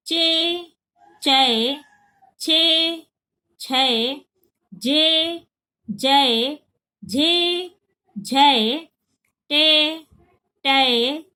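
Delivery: each syllable spoken slowly and separately, े then ै; contrasting vowel drill. Shown as a demonstration. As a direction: clearly separate the two vowel sounds. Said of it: "एक बार और repeat कर देती हूँ". (हर अक्षर अलग-अलग साफ़ बोलना)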